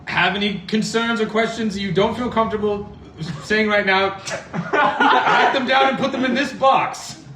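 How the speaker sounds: high, slow, grand captain voice; pompous captain. Acted. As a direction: lord it over the crew. Captain addresses crew in character.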